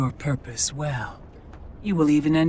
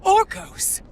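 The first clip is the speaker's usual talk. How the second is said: a piercing, much higher cry of the name; shrieked name. Acted. Fury catching her son's betrayal, shrieking his name. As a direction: screech it in outrage.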